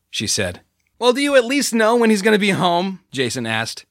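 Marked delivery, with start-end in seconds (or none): 1.0-3.0 loud, higher, almost shouting; near shout. A narrator's reading. Jason talking over the big guy to cover the noise, nearly shouting. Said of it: "He was practically shouting".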